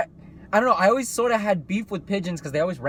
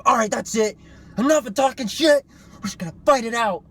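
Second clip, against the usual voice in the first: a touch higher and louder, picking a fight; mock tough guy; 6 semitones higher, 5 dB louder than usual. Mocking sensitive people who want to fight, a mild tough-guy voice. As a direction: play the touchy guy who's had enough.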